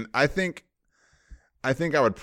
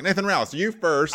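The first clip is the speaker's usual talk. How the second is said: higher, bossy host calling on someone; mock host voice; 8 semitones higher than usual. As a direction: call on him like a grand host. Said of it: "You're like".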